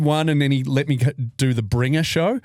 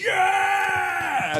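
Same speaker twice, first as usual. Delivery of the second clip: high cheering yell; cheering crowd. Retold or imitated.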